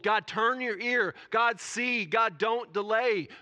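loud, high, slow demands; bold pleading. Acted. Preacher voicing Daniel's prayer, bold and direct. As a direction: call on God boldly, punching each phrase. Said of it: "can we actually be so humble, bold?".

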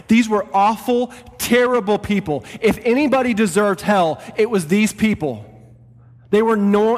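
building sermon delivery; full preaching. Acted. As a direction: preach it to the whole church with fire. Pastor preaching to church.

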